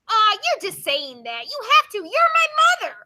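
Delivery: building to a high, loud protest; acted child's protest. Child brushing off mother's praise, hammed-up protest. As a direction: push back at mom, rising sharply in pitch and volume.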